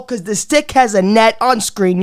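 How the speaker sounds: ordinary talk, nothing marked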